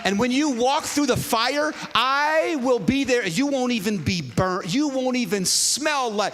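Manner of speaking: loud, high, stretched-out preaching; forceful proclamation. Real, not acted. Preacher proclaiming God's promise through fire, forceful proclamation. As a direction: build the promise at full voice, stretching key words.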